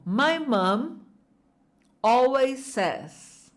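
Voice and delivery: two slow blocks with a pause. Shown as a demonstration. Teacher chunking the phrase for students to repeat. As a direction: say my mom, pause, then always says slowly. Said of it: "De novo".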